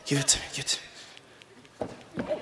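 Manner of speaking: hushed, voiceless grab; whispered demand. Pretend. Volunteer's whispered grab acted by pastor. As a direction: whisper it greedily.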